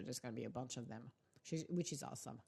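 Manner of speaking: talking while eating; mouth full. Real, not acted. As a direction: talk through a mouthful. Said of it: "sorry, I'm eating".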